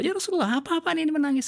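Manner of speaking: high-pitched imitated protest; protes. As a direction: play the shocked companion objecting. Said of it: "diprotes sama sahabat". (sahabat memprotes Rasulullah, nada tinggi dan heran)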